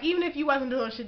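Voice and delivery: ordinary talk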